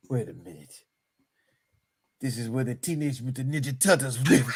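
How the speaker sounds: low, hushed movie-hero voice; softly spoken, deep-pitched action-star musing; Jean-Claude. Acted. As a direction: drop low and quiet, dawning realization. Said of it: "John Claude like this".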